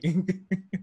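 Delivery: quick real laugh; a short amused laugh. Real, not acted. Host laughing at being savaged, short laugh.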